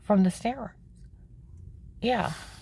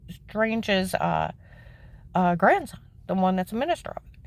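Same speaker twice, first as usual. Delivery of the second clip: ordinary talk, nothing marked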